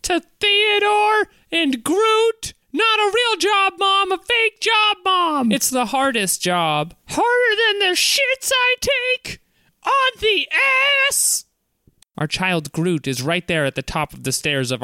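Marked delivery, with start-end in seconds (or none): 0.0-11.4 much higher, bitter housewife voice; shrill wife voice. Acted. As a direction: play the fed-up wife, voice pitched way up. Wife rant high voice.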